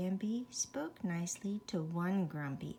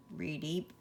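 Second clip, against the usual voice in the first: frog croak; 4 semitones lower than usual. A narrator's reading. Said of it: "said the frog".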